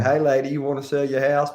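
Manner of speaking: high, loud Southern twang, drawn out; drawn-out Southern drawl. Acted. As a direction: lay on a drawling Southern accent. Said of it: "you can draw it out and say".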